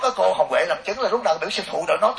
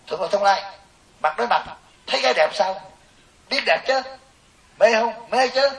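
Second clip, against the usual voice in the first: higher, louder quick question and answer; higher, louder playful back-and-forth; mock interview; 7 semitones higher, 5 dB louder than usual. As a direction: play both sides of a cheeky interview, raised and loud.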